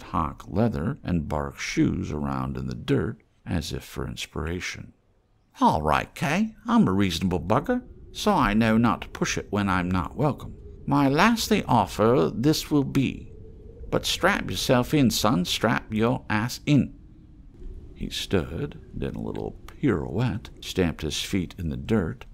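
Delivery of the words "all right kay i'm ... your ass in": higher, sly haggler's voice; wheedling trader voice. Acted. Scruffy trader teasing the mole, higher and sly. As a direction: coax the stranger like a street trader. Reno's last offer.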